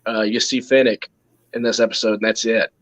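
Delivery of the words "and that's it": usual speaking voice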